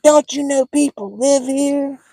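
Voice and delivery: high, loud, stretched-out mock scolding; screaming lady impression. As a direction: screech it slowly like an irate neighbor. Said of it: "And she's screaming".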